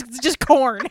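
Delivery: usual speaking voice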